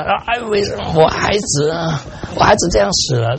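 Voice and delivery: high, keening lament of a bereaved mother, retold; preacher voicing a grieving mother's wail; imitated mother's wail. Grieving mother wailing over son.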